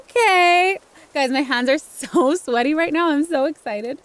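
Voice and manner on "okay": high, loud, drawn out; gasp, then stretch the word out loud; overwhelmed excitement. Real, not acted.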